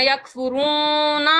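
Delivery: یکفرون with the final نا dragged very long; یکفرون with a long final vowel; wrong stretched noon. Shown as a demonstration. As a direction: overdraw the نون ending. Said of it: "اسے یوں نہیں پڑھنا".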